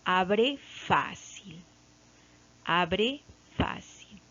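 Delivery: "Abre fácil" drawn out very slowly, each syllable clear; teacher reading the example word very slowly; slow demo. Shown as a demonstration. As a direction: stretch out "Abre fácil" so the class hears the word. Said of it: "se mantiene la tilde".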